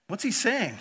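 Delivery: normal talking voice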